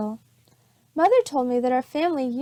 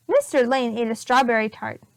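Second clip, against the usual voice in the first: ordinary delivery, nothing marked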